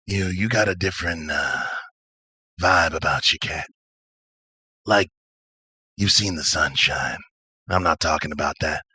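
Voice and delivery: low, unhurried drawling character; laid-back drifter. Acted. Stranger sizing up a newcomer, laid-back. Game master voices Patch.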